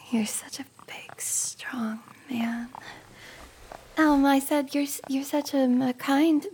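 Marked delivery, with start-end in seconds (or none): 0.1-2.7 soft breathy tease; flirty whisper. Acted. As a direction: whisper it suggestively. Flirty whisper, then corrects.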